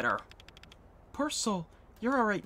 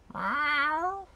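high, long meow with a rise; cat meow; 10 semitones higher, 4 dB louder than usual. Acted. Cat answering the girl with a high meow. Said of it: "What was that meow about?".

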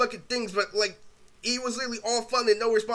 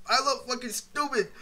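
ordinary talk, nothing marked

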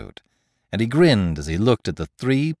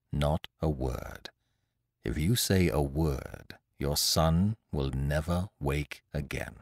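low, soft and slow, reading a threat; quiet sinister read; menacing note; 6 semitones lower, 7 dB quieter, slower than usual. A narrator's reading. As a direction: read it like a cold whispered warning.

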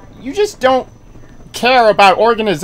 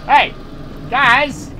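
usual speaking voice

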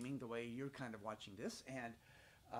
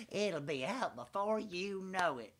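higher, slow, louder prim lady's voice; Miss Sally Lynette voice; 5 semitones higher, 7 dB louder, slower than usual. Retold or imitated. Miss Sally Lynette tells the thirsty boy, in a high, slow, prim lady voice. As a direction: sing it out sweetly like a know-it-all visiting lady. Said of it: "Miss Sally Lynette said".

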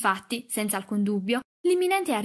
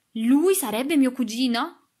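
slow, loud, higher incredulous question; scornful exclamation; 4 semitones higher, 6 dB louder, slower than usual. A narrator's reading. Girl told the boy is her cousin, scornful. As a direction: draw it out with disdain. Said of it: "esclamò Katie, con una risata sprezzante".

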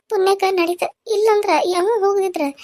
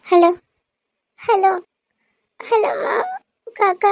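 tearful call; 3 semitones higher, much slower than usual. Acted. Caller reaching the uncle with bad news, tearful. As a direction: voice catching with tears on the phone.

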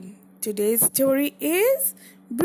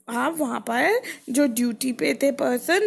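ordinary delivery, nothing marked

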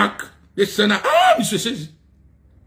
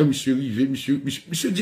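ordinary delivery

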